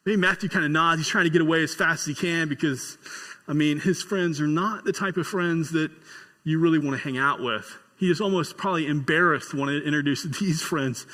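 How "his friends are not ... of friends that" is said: usual speaking voice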